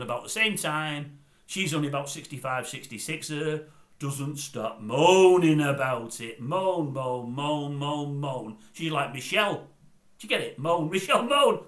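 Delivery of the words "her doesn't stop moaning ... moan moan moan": slow mocking drag. Mocking Leader's moaning.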